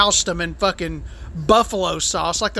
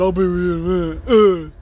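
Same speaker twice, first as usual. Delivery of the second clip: dismissive waiter; 3 semitones lower than usual. Retold or imitated. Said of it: "when he came out and said".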